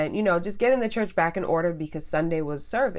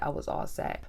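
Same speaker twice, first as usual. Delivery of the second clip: whispered, much softer, final; hushed emphatic whisper; 8 dB quieter than usual. Real, not acted. Telling viewers she was done with him, in a soft whisper. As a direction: drop to a whisper to drive it home. Emphatic repeat after "when I tell you.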